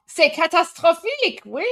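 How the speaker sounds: lilting French c'est catastrophique; mock French. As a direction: faux French exclamation. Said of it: "Armin is doing".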